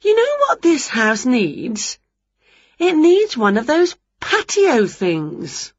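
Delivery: high, slow, savoured suggestion; Winnie, having an idea. A narrator's reading. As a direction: announce a bright idea with drawn-out words.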